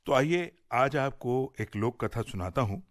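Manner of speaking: ordinary delivery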